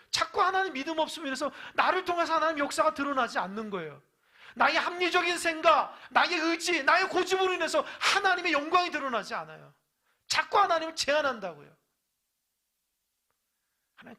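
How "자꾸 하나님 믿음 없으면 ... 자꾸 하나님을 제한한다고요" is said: high, loud shouted sermon; fiery preaching. Acted. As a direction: preach it at full force. Preaching at full force.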